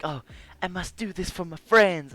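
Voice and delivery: anime protagonist trope. Acted. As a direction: declare it like an earnest anime hero. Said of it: "like, anime trope".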